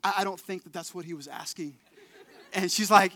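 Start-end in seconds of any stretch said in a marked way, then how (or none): 0.0-1.8 sheepish aside. Retold or imitated. Embarrassed aside to his wife.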